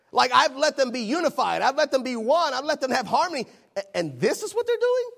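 incredulous God. Acted. God dismayed at the people He unified, incredulous. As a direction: plead in disbelief, then let the last question shoot up. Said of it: "I can't believe that this is".

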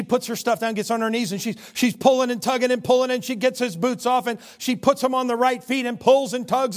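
high, loud exasperated telling; energetic comic narration; animated struggle. A narrator's reading. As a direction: build the tugging. Tells the struggle with boots.

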